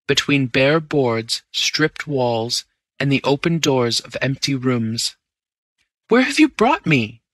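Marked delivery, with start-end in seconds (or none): 6.1-7.2 sharply higher, louder cry of alarm. A narrator's reading. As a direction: call out in shocked fear, high. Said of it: "I cried".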